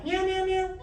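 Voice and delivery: high meow meow meow; cat meow. Shown as a demonstration. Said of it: "Can you meow like a cat?".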